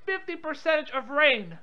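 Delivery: ordinary talk, nothing marked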